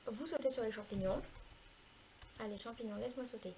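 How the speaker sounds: ordinary delivery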